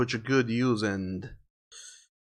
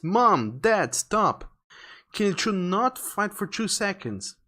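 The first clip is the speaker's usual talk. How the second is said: higher-pitched plea to stop; plead, pitched up, fed up with the bickering; exasperated pleading; 8 semitones higher, slower than usual. Acted. Child to fighting parents, exasperated plea.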